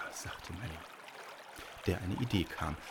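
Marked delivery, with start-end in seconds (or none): none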